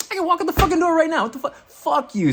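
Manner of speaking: very high, loud outburst; hammed-up screechy defiance; defiant mock yell. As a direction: shout back in acted outrage. Retold defiance at the inner voice.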